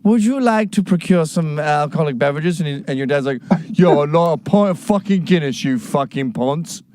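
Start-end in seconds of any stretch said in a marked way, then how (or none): 0.0-2.6 mock-posh, camp British accent. 3.7-6.8 put-on rough working-class British accent, dragged out; working-class yob accent. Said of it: "has a working class yob accent".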